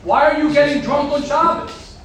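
normal talking voice

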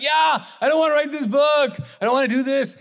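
high, loud whining confession; whiny admission. Retold or imitated. Husband admitting to his wife he avoids writing, whining. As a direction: wail it like a caught kid. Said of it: "I'm like".

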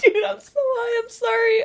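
high, laughing apology; can barely talk for laughing; giggle fit. Real, not acted. Laughing fit mid-podcast.

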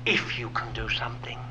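whispered desperate plea from the father; the father begging Jesus, whispered; hushed plea. Retold or imitated. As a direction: beg in a hush. Father's plea retold softly.

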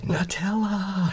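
slow, high, stretched-out word; drawn-out mock plea. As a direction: drag it out, high and pleading. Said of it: "being like".

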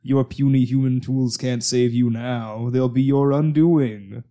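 slow, loud, gloating monster voice; booming arrogant alien overlord. Pretend. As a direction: lord it over puny humans. Monster lecturing humans, slow, loud.

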